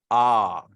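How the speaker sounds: long, low, loud "Ah" with no r; "Ah" with a flat tongue; silent R. Shown as a demonstration. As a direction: hold "Ah" open and leave the r out. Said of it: "you won't get, you know, these sound".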